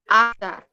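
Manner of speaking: AFTA in two beats, af then ta; two separate syllables. Shown as a demonstration. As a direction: open the mouth twice for afta, AF-TA. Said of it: "abro duas vezes a minha boca".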